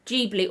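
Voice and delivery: "Ghibli" pronounced JEE-blee. Shown as a demonstration. Vlogger listing option two, Ghibli as JEE-blee. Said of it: "I've never heard it pronounced".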